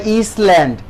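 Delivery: "ইসল্যান্ড" with the S sounded, as is-land; mistaken S sound. Shown as a demonstration. Teacher voices a pupil's wrong guess, "ইসল্যান্ড" with S pronounced. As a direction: say "ইসল্যান্ড" starting with an "iss". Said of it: "এস এর উচ্চারণটা silent থাকবে".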